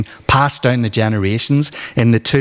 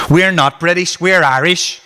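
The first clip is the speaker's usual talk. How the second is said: heated shouting; 6 semitones higher than usual. Acted. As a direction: yell it back defiantly. Kids shouting in heated argument.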